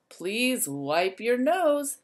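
ordinary delivery, nothing marked

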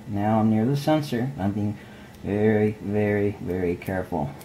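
hushed. Real, not acted. Hushed near exposed sensor.

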